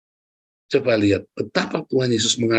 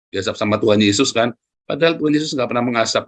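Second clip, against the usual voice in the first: ordinary talk, nothing marked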